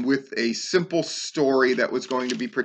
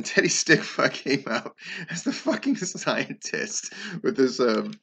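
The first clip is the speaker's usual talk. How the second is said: real amused laugh breaking through the words; laughing while talking. Real, not acted. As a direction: tell it through helpless chuckles. Amused by silly costume reveal.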